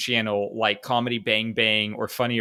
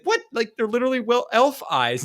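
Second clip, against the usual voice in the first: Aragorn defensive; 11 semitones higher than usual. Pretend. Aragorn protesting to Legolas, baffled and high. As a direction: defend yourself, confused. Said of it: "he's like".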